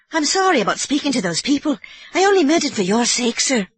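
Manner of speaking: put-on old Irish housekeeper explaining herself nervously; Mrs Leahy.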